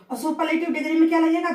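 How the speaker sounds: ordinary delivery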